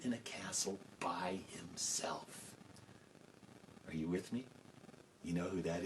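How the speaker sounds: whispered, hushed aside; whispered hint. A narrator's reading. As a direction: let it drop into a secret.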